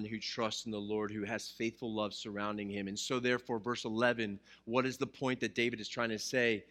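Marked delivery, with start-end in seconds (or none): none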